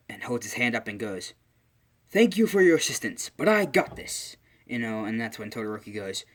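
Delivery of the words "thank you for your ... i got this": high, loud hero proclamation; acted heroic assurance. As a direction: boom it out like a confident hero, play-acting. All Might confidently declines help.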